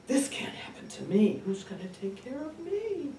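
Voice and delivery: rising, high-pitched panic; acted dismay. Daughter facing her own old age, plaintive alarm. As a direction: climb to a high, plaintive wail on the last word. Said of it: "and I go".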